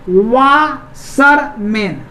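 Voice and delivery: Washerman very slowly, pausing between वा, शर, मैन; three long separated beats on washerman; split into syllables. Shown as a demonstration. Teacher showing it divides in three, split into syllables. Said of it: "तीन syllable में बंट रहा है".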